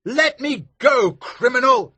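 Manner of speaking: Maskew shouting. Acted. Maskew shouting at Elsevier, high outraged shout. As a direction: shriek at your captor. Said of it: "shouted Maskew".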